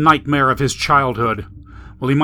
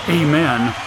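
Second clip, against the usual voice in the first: usual speaking voice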